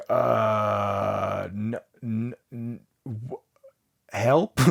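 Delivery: soft, halting, stunned stammer; frozen stammer. Acted. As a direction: lose all words in shock. Stunned receptionist freezes.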